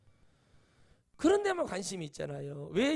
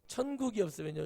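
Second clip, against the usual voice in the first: normal talking voice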